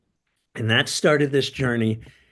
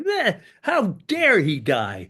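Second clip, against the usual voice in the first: mock indignation; 7 semitones higher, slower than usual. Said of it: "No, he was like".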